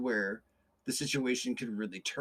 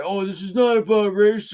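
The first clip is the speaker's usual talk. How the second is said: high, much louder sarcastic denial; hammed-up indignant protest, higher and louder; mock protest; 8 semitones higher, 12 dB louder than usual. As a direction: play the defensive clerk with a sneer. Mock reply voiced in retelling.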